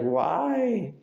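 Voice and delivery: high, loud squeaky "Why?"; mock whiny why. Imitating the frustrated person, high squeak. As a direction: whine it. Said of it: "You're gonna be like".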